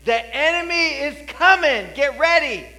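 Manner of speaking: a lookout yelling that the enemy is near; loud, high alarm call; watchman's warning cry. Acted. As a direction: shout it high and loud from the wall. Watchman calling warning from wall.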